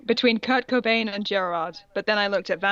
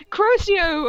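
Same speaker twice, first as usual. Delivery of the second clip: higher, louder battle cry; mock spell shout; 9 semitones higher, 5 dB louder than usual. Fanfic reader acting the hero casting a curse. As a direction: cast the curse with a hammy yell. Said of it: "I shited".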